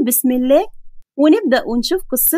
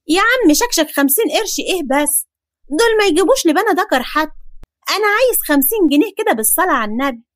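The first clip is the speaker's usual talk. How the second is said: put-on higher, cheeky kid; protesting boy; 4 semitones higher than usual. Boy scoffing at his neighbour, higher. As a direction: scoff at the small offer.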